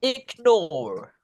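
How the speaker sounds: high, clear model words for the class to echo; repeat-after-me drill. Shown as a demonstration. Teacher leading the class in a repeat-after-me drill, high and clear. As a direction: call out each word to be repeated. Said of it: "repeat after me".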